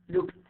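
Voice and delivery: "looked" as one syllable ending in T; final T sound. Shown as a demonstration. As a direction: say "looked" like lookt. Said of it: "I pronounce E D as T".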